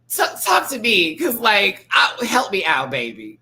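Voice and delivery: high, loud begging; mock desperate begging; loud plea. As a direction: call out pleading to him. Imagined plea to Ken.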